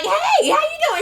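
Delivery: high cheerful greeting. Retold or imitated. [name] greeting [name], high and friendly.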